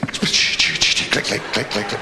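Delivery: crisp clicking sounds; switch clicks. Acted. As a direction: imitate flicking the light switch. Imitating light switch.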